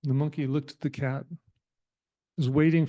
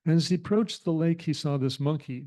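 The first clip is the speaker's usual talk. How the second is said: normal talking voice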